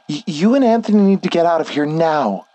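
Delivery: panicked high-pitched insistence; frightened urging. Acted. Frightened woman urges narrator to flee.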